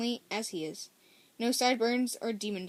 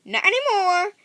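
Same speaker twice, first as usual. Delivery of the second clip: high, loud Southern chirp; much higher, louder Southern character voice; chirped Southern accent; 10 semitones higher, 9 dB louder than usual. A narrator's reading. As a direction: call it out brightly with a Southern accent. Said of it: "Ellie trips over her so-, shoulder".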